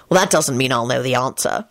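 low-pitched resigned reply. A narrator's reading. Lupin defending himself to the group, resigned. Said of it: "he said resignedly".

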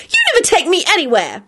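put-on high-pitched childish wail; girl complaining to her brother. A narrator's reading. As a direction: whine it like a sulking kid. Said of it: "Rosie wailed".

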